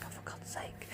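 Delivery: whispered exasperation; whispered annoyance; exasperated whisper. Retold or imitated. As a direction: mutter it under your breath in frustration. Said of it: "And I'm like".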